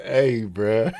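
usual speaking voice

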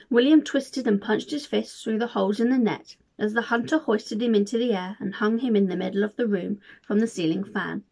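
ordinary delivery

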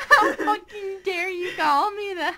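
loud, slow hammed-up indignation; mock outrage. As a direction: fake offended through laughter. Mock offended reply, laughing.

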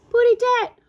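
high, loud puffed-out cry; out of breath. A narrator's reading. Tweety fleeing the cat, out-of-breath high cry. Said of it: "Tweety puffed out of breath".

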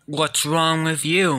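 ordinary talk

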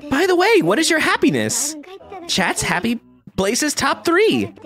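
high, squeaky put-on villager. Game villager asking the kid about his happy places. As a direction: chirp the question like a nosy neighbor.

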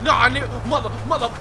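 ordinary delivery

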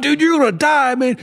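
high, laughing tease; mock teasing. Teasing Todd in his gas mask, in a high laughing voice. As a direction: taunt him gleefully, high. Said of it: "I'm like".

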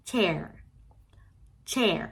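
"chair" with a short, quick CH burst. Shown as a demonstration. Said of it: "จะพูดสั้นๆว่า". ("chair" เสียง ch สั้นๆ)

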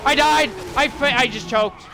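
sudden high, loud cry of dismay; shocked yelp at dying; real outburst. Real, not acted. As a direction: blurt it out as you go down. Sudden death in the game.

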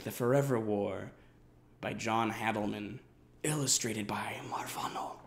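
reverent whisper; awed, hushed aside. Acted. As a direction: breathe the name like something sacred. Reverent mention of favourite book.

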